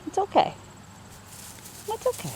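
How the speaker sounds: high, fading reassurance to a frightened pet; soothing pet voice. Real, not acted. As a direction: coo softly, trailing off to calm her.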